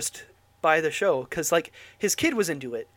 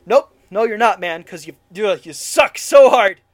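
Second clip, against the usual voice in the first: real yelling; 3 semitones higher, 11 dB louder than usual. Real, not acted. Host ranting back at the director, yelling. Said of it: "we're yelling here".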